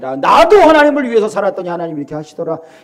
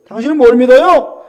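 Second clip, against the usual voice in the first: challenging question; 4 semitones higher, 6 dB louder than usual. Acted. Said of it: "우리에게 그래요".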